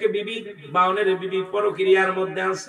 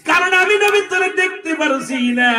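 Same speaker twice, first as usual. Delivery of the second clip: high, loud sermon cry; full-force preaching; 10 semitones higher, 5 dB louder than usual. A narrator's reading. Preacher voices the forbidding dream to the son, high loud preaching.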